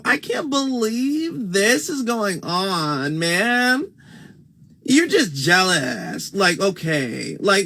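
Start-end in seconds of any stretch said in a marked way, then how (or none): 0.0-6.3 high, slow, stretched-out ditzy voice; mock the girl's put-on act, drawn out; put-on valley girl act. Mocking the girl from the video in a high, stretched valley voice. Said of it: "she was putting on an act".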